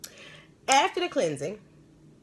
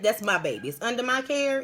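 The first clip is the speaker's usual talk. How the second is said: normal talking voice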